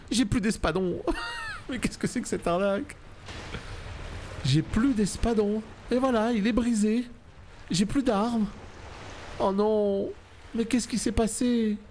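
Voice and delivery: high panicked voice, near squealing. Real, not acted. Gamer panicking at his broken sword, high frantic voice.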